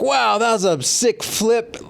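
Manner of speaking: mock excited dad; hyping dad. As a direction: pump up the kid after a fall. Dad praising fallen kid.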